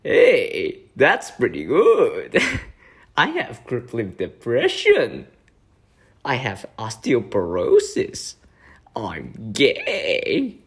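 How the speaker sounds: slow, exaggerated swooping catchphrases; mock drawn-out YouTuber voice; iDubbbz impression. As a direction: drag out each line with big pitch swoops. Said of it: "I sound like iDubbbz".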